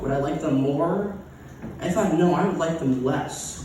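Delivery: ordinary delivery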